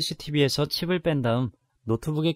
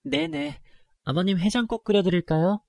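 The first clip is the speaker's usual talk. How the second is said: half-hearted cheerful reply; 7 semitones higher than usual. Acted. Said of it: "대충 웃으며 대답해드렸어요".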